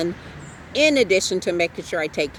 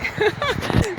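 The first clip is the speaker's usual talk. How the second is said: quick bright giggle; a light laugh after the joke; short high laugh; 14 semitones higher than usual. Real, not acted.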